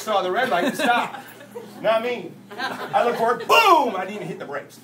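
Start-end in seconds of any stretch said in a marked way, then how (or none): 3.5-4.0 loud, high "boom"; crash imitation. Driver retelling his wreck, explosive crash sound. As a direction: burst out with the impact noise.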